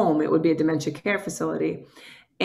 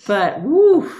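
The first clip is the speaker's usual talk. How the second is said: higher, louder burst of air; woo exclamation; 5 semitones higher, 7 dB louder than usual. Real, not acted. Louder higher woo telling the host that caregiving is a ride. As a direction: let out a loud woo.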